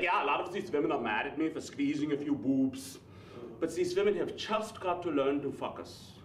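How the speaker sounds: thick Austrian accent impression; Austrian-accented governor impression; Arnold. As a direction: botch the vowels in a heavy Austrian accent. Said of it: "Arnold, he gets in trouble".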